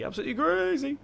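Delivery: very high-pitched mock disbelief; hammed-up squeal of playful disbelief; playful exaggeration. Playful exclamation, then says sorry.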